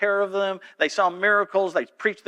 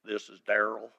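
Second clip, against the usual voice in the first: low, soft put-on patient voice; Daryl voice again; 8 semitones lower, 8 dB quieter than usual. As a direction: reveal the prank in the patient's voice. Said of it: "I said".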